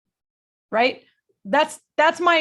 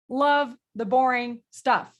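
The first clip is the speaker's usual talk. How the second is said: slow, emphatic motto; slower than usual. Real, not acted. Coach stating the motto.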